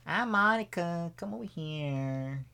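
slow, soft come-hither impression; Monica Lewinsky. Co-host as Monica calling the president over, slow and soft. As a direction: draw it out softly and invitingly. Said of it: "You don't know how Monica Lewinsky sounds".

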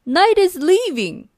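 high, loud jeering yell; grumpy monster shouting at dawn; shouted. Acted. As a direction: yell it across the mountain. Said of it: "he shouted".

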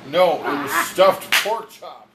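loud, slow, heated insistence; emphatic correction. Real, not acted. Co-host disputing chicken versus pork chop, heated and emphatic. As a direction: hammer out the correction.

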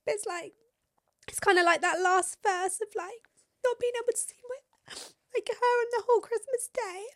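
high, tight tearful voice, near crying; fighting back tears as she talks. Real, not acted. Tearing up about missing her daughter at Christmas, tearful. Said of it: "didn't think I'd be that upset".

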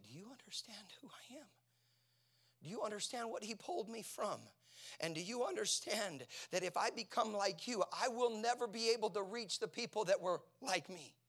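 high, urgent appeal building in force; impassioned plea. Retold or imitated. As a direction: retell a pleading protest, pitched well up. Retells pleading with church people.

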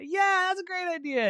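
high, delighted yes; eager agreement. Acted. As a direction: jump at the idea in a high voice. Avery agrees to bed's idea.

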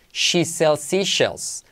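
very slow, each "sh" and "s" drawn out; "She sells seashells" word by word; slow tongue twister. Shown as a demonstration. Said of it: "usar ritmo con esto". (trabalenguas muy lento, marcando "sh")

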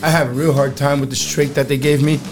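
ordinary delivery

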